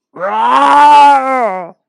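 loud monster roar; angry ogre roar. Acted. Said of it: "roared with anger".

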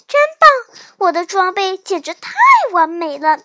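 loud, jubilant exclamation; thrilled cheer over new gear; elated. A narrator's reading. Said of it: "小南瓜兴高采烈地又蹦又跳".

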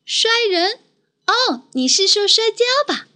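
高而惊讶的小女孩声; 惊奇地追问; surprised little girl. Acted. (小妹妹惊讶地问)

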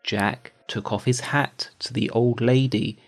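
usual speaking voice

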